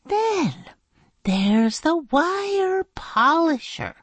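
high, very slow, grand teacher explaining; high, drawn-out teacher lecture; slow earnest explaining. Acted. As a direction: lay it out with reverence. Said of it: "teacher explaining".

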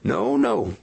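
hasty. A narrator's reading. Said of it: "continued Tennessee's partner hastily".

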